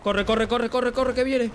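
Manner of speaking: high, loud frantic yelling; panicked shouting. Real, not acted. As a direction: yell at him to run. Enemy chasing, urging to run.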